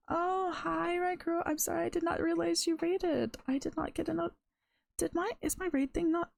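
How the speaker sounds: real surprised, high-voiced apology; flustered surprise. Real, not acted. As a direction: pitch jumps up, caught off guard. Startled streamer notices a missed raid.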